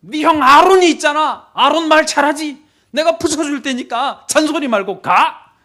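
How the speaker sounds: high, loud exasperated shouting; acted furious God, out of patience; blowing his top. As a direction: shout down the excuses. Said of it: "뚜껑이 열려버리셨어요".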